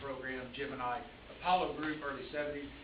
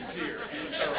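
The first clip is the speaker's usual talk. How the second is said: words squeezed out through a laugh; 13 semitones higher, 4 dB louder than usual. Real, not acted. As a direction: chuckle through the phrase. Joking about an intense review.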